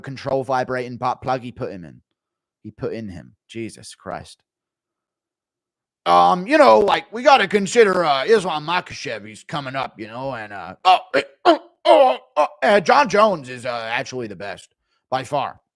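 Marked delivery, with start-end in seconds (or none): 6.0-15.6 a hesitant champ dodging; John impression. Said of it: "Impression of John".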